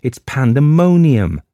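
loud exasperated outburst; crying out. A narrator's reading. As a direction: burst out over the bells. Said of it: "cried Gerald".

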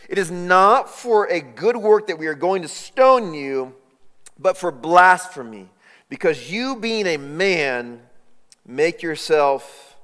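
slow, loud, deliberate charge; stern accusation. Acted. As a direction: pronounce the verdict.

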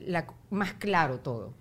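ordinary delivery

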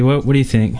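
ordinary talk

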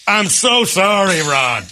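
high, slow mock wail; hammed-up begging for forgiveness; wailed apology. Imitating the partner's repeated apology mid-game.

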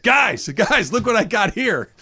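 high, loud proud alligator showing off his catch; gloating alligator. Retold or imitated. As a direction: crow like a proud gator with a prize. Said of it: "he'd have looked".

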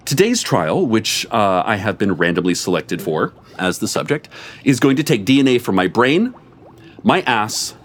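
ordinary delivery, nothing marked

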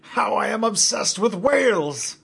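delighted captain shouting out his obsession; gleeful shout. Acted. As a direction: proclaim your obsession with glee. Gleeful captain shouting about whales.

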